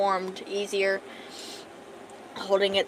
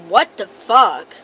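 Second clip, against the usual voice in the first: loud, slow, startled what the fuck; sudden outburst; 12 dB louder, much slower than usual. Real, not acted. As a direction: blurt it out in shock. Sudden startle mid-sentence.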